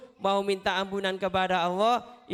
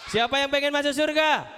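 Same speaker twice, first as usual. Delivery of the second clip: rallying call; 7 semitones higher than usual. Real, not acted. Calls out to children.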